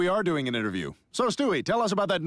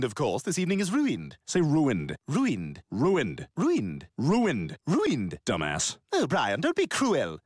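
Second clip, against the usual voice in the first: usual speaking voice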